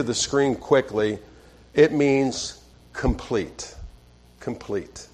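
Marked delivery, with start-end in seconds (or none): 1.7-3.7 slow, drawn out "complete"; deliberate, slowed teaching; slow emphasis. Shown as a demonstration. 4.4-5.1 soft echo. Shown as a demonstration.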